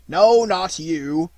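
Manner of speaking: slow, loud refusal in a different voice; gruff jailer. Acted. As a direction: jailer turning him down, loud and deliberate.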